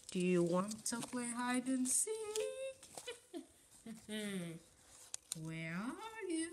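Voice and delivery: lightly voiced old woman; a hint of Granny calling; elderly singsong. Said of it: "why do you guys talk like Granny".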